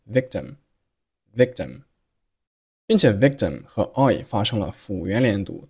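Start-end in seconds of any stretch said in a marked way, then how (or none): 0.1-1.8 very slow "victim", c held silent before t; "victim" with the k closed but not released; unreleased k in victim. Shown as a demonstration. Teacher slowly showing the silent c in "victim". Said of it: "读成了victim，victim".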